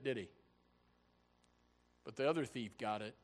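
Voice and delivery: usual speaking voice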